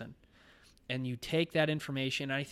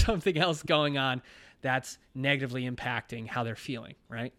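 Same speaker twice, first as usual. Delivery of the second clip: usual speaking voice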